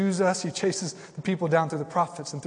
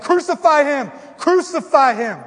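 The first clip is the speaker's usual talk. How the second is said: high shouted crowd chant, slower; mob shout; 11 semitones higher, 10 dB louder than usual. Retold or imitated. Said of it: "I wasn't the one shouting".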